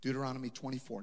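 normal talking voice

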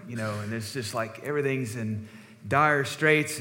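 usual speaking voice

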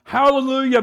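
high, loud hallelujah; joyful hallelujah. Retold or imitated. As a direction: cheer it out. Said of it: "I'm like".

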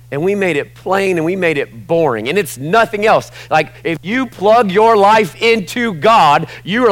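high, increasingly loud sermon voice; fired-up preacher, pitch climbing; impassioned preaching. Real, not acted. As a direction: build fervently toward a climax. Preaches at full force.

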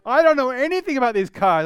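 imitating a breezy salesman, high and loud; car salesman friend. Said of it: "And he goes".

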